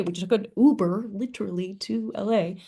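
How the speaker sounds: usual speaking voice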